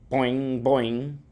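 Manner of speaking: lightly voiced frog going boing; funny frog sound. A narrator's reading. Pet frog replying to the hamster, funny croak-like boing. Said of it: "He makes a funny sound".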